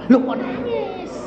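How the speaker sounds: high-pitched incredulous question; mock astonishment. Preacher voices God questioning the weeping believer, mock astonished. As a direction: squeal it in mock surprise.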